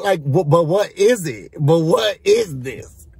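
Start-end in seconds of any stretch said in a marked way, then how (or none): 1.6-3.1 slow, baffled question; drawn-out disbelief. Real, not acted. Recapper still questioning the nap invitation, drawn-out disbelief. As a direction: drag it out, incredulous.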